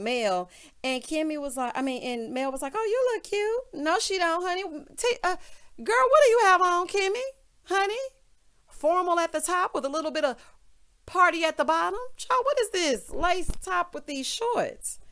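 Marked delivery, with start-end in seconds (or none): 2.8-3.8 high, slow sugary mock compliment; lightly mimicking a gushing friend; mock Mel. Mocking Mel's compliment. 3.8-15.0 clutch the pearls at her outfit; hammed-up shocked auntie; sassy mock roast. Mocking Kimmy's outfit.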